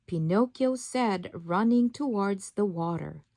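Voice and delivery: ordinary delivery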